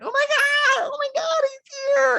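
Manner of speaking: very high, loud excited squeals; hammed-up shrieking frenzy; mock screaming. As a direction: squeal like a roomful of excited women. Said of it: "every single lady begins screaming like".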